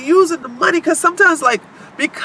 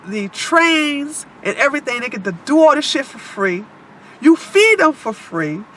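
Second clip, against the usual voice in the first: usual speaking voice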